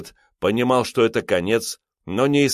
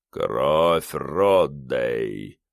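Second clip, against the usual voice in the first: deep, slow otherworldly rumble; dark lord; 7 semitones lower, much slower than usual. A narrator's reading. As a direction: rumble it like a voice from the grave. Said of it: "пророкотал жуткий, потусторонний ... голос".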